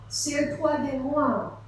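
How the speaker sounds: ordinary delivery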